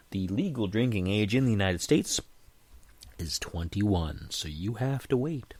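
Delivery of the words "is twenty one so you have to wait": ordinary delivery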